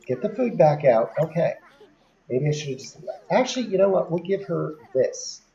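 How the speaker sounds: normal talking voice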